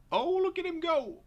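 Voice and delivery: very high, loud amazed shouting; real high-pitched whooping excitement; excited yelling. Real, not acted. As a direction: yell in amazement, breaking into laughter. Reacting to runaway truck on screen.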